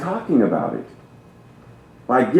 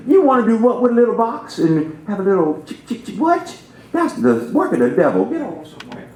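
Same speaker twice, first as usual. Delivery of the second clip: higher, disbelieving scoff at a horseless carriage; mock the doubter who can't believe it; incredulous skeptic; 8 semitones higher, faster than usual. Minister mimics an old skeptic scoffing at cars. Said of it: "someone yonder hinder said".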